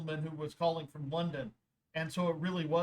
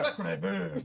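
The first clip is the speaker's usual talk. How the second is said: put-on silly French accent. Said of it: "I can talk in a silly French accent".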